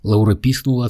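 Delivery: plain reading voice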